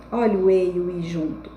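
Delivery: normal talking voice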